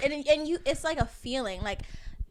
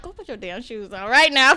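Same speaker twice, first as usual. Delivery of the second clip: stern mom; 9 dB louder than usual. Retold or imitated. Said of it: "in my head I was like".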